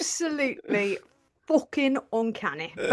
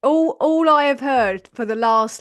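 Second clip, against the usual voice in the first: ordinary delivery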